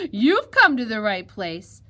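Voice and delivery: loud, slow, relishing welcome; gloating cry. Acted. Fox welcomes the piglet as dinner, crying out. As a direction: draw it out loudly in triumph. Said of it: "cried the fox".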